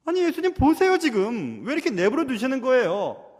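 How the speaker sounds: high, loud indignant protest; loud complaint. Acted. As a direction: act out a sister complaining to Jesus. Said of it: "주님께 불평하고 있지 않습니까".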